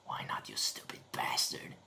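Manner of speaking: hissed under the breath; angry whisper. Real, not acted. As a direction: curse the software in a whisper. Whispered curse at software.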